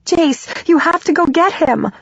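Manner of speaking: high, desperate begging; acted panicked appeal; frantic plea. A narrator's reading. Frantic plea to stop a fight.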